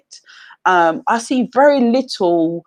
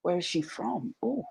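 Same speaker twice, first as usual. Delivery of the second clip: much softer, curious murmur of onlookers; hushed gossip; 10 dB quieter than usual. Retold or imitated. Strangers speculating about her background, in a hushed curious murmur. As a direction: mimic strangers wondering under their breath. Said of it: "I get treated more like".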